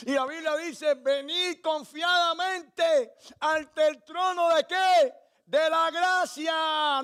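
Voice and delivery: full-force pulpit preaching. Acted. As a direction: preach it out, pleading for surrender.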